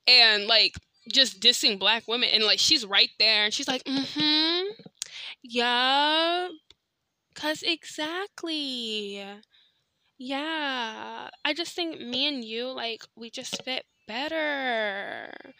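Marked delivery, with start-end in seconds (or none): 3.8-15.5 soft, slow, sugary put-on voice; mock girlfriend voice. Mocking the girlfriend agreeing with the uncle in a soft slow voice. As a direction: purr it agreeably, slowly and softly. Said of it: "she didn't talk like that".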